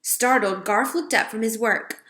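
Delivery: ordinary delivery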